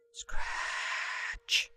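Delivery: very soft whispered scratch; faint scratch. A narrator's reading. Boy scratching the wall lightly, faint whispered scratch. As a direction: barely breathe the scratch. Sound effect, lighter touch.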